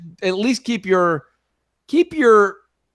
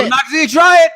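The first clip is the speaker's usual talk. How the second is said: louder high put-on voice; 11 semitones higher, 7 dB louder, faster than usual. Echoes the phrase even louder.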